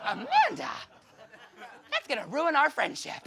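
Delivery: put-on boy pleading with Amanda, high and panicked; panicked refusal. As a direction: squeal her name in alarm, half laughing.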